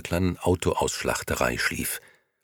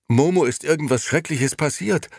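higher, louder anxious question; fearful outburst; 10 semitones higher, 4 dB louder than usual. A narrator's reading. Beppo breathless, telling Gigi something terrible happened. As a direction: ask with rising alarm. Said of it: "stieß Beppo hervor, der nach Atem rang".

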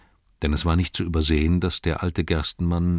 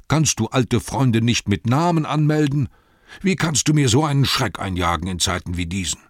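loud, much higher scolding; scolding shout; 6 semitones higher, 4 dB louder than usual. Acted. Innkeeper scolding his servant Nob, loud shout. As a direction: yell at the servant. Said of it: "rief er".

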